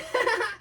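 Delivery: high gleeful laugh. Acted. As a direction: giggle high and triumphant. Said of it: "he laughed".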